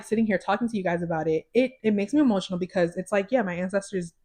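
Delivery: usual speaking voice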